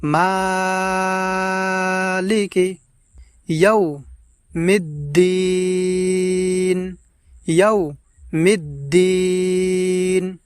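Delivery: মালিকি and ইয়াউমিদ্দিন with long held vowels; drawn out recitation. Shown as a demonstration. Teacher demonstrating for viewers, slow stretched recitation. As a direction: chant it slowly, stretching the vowels. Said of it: "আওয়াজটাকে একটু লম্বা করে পড়ব এইভাবে".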